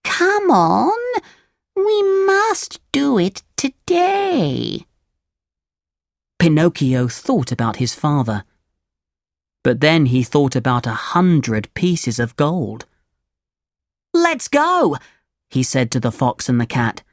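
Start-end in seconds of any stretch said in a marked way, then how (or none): 0.0-4.9 high, slow, drawn-out cat voice; storybook cat, high and lingering; urging cat. A narrator's reading. The cat urges Pinocchio in story. 14.1-15.0 put-on high puppet-boy voice; high, keen storybook Pinocchio; eager Pinocchio. A narrator's reading. Pinocchio agrees to the plan.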